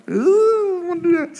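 very high, loud squealed outburst; sudden loud falsetto squeal. Real, not acted. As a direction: squeal it out, embarrassed by a sniffle. Awkward sniffle into the mic.

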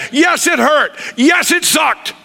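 high, rising to a shout; sermon climax; preaching shout. Real, not acted. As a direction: hammer it home at full force.

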